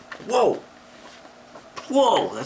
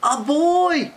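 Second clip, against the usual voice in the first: much higher, loud yell; 13 semitones higher, 6 dB louder than usual. A narrator's reading. Bear yelling when he sees the boy. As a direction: yell it in shock. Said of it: "yelled the bear".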